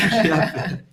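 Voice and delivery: echoes the words through a laugh; a laugh over "longe a pé". Real, not acted. Co-host laughing at the brother-in-law story.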